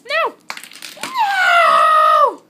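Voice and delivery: loud, high despairing cry; anguished shout. Real, not acted. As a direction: wail NO in real dismay. Dropped the best ice cube.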